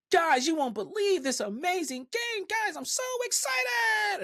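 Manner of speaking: very high, loud, drawn-out hype voice; pumped-up streamer. Acted. Creator mocking a hyped TikTok video intro, over-excited. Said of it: "this guy seems pumped".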